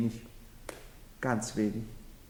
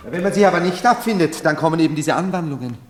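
raised voice; 8 semitones higher, 7 dB louder, faster than usual. Acted. Heinrich lecturing the Marchioness.

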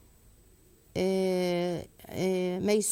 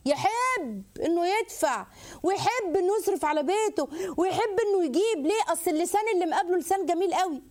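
mock proud husband; 8 semitones higher than usual. Mimics an appreciative husband.

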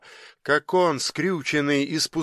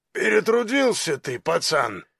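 amused big thug, pitch jumping up at the start; smirking giant; 3 semitones higher than usual. A narrator's reading. Giant teases the revived youth, smirking. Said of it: "усмехнулся громила".

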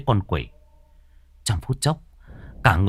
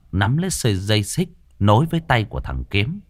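plain reading voice

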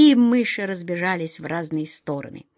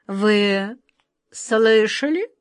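slow, commanding queen; imperious; much slower than usual. A narrator's reading. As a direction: draw it out with authority, rising at the end. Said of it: "властно произнесла она".

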